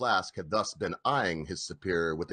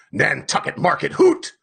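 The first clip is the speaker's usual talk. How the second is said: scornful repeat rising to a hoot; mocking echo; 5 semitones higher, 6 dB louder than usual. A narrator's reading. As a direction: throw his words back with scorn. Ahab mocks Starbuck's words.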